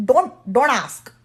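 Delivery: normal talking voice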